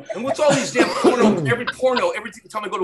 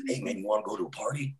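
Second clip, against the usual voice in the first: hushed invitation; 10 semitones lower, 11 dB quieter, faster than usual. Retold or imitated. Quiet mock invitation.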